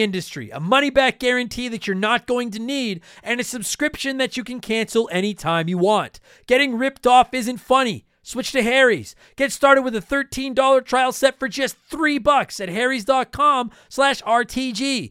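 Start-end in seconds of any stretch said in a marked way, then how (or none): 6.5-15.1 upbeat ad read. Acted. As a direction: read it like a sponsor spot. Recorded ad read.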